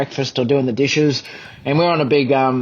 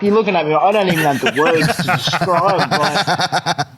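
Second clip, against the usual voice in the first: normal talking voice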